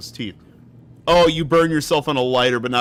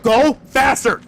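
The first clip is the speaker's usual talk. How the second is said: higher, louder desperate urging; panicked plea; 10 semitones higher, 6 dB louder, slower than usual. Real, not acted. Urging while chased, louder.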